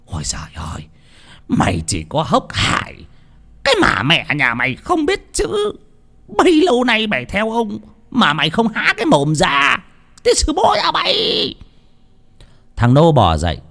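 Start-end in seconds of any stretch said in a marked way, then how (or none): 0.1-11.1 climbing to a high shriek; shrieking curse. A narrator's reading. Old master kicking the servant, shrieking in rage. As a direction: screech the curse at him. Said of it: "mà rít lên".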